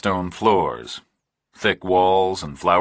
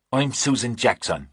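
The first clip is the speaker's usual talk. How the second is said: fake English accent; 5 semitones higher than usual. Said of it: "your accent isn't real convincing anyway".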